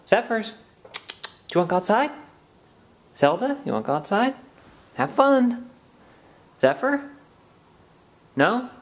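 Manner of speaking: high sing-song voice for the cats; high pet talk. Real, not acted. Owner asking her cats to go outside, high pet voice.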